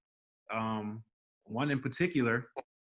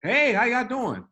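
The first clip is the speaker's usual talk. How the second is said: much higher, louder, slow sing-song hello; exaggerated cheery greeting; 11 semitones higher, 5 dB louder, slower than usual. Concierge hamming up his daily greeting to the resident, high and slow. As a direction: overdo the friendly hello, stretched out. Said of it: "I'm talking about".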